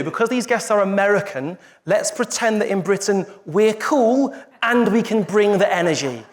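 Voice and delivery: ordinary talk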